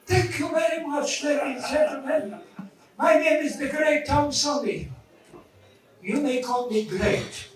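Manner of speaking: put-on high-pitched Polish-accented magician's introduction; Polish stage voice. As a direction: announce yourself grandly in a high Polish accent. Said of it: "when I work in a Polish voice".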